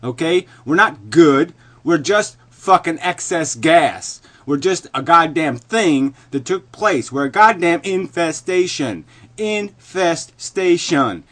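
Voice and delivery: very loud, high, emphatic tirade; furious rant. Real, not acted. Condemning humanity as an infestation, furious rant. Climax of rant against the universe.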